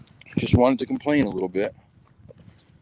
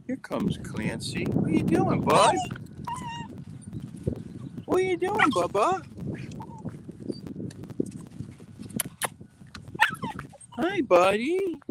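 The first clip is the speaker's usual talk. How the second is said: high sing-song voice for the dog. Real, not acted. Greeting the dogs in baby talk.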